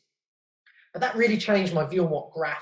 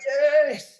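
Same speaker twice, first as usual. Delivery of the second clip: high, loud cheer; excited yes; 8 semitones higher, 6 dB louder than usual. Retold or imitated. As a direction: cheer it like a proud Bristol fan. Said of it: "they're like".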